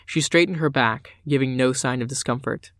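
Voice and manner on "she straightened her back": usual speaking voice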